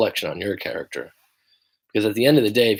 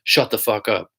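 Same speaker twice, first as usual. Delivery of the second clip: shoulder voice shutting it down. Retold or imitated. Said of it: "this other dude's like".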